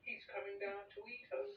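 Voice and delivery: high, frightened wail. A narrator's reading. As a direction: wail it in alarm. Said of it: "wailed the old green grasshopper".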